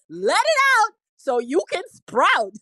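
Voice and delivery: high, loud, gleeful rhyme; amused holler between bursts of laughter; tickled repeat. Real, not acted. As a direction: belt out the rhyme, cracking yourself up. Repeats own rhyme, cracking up.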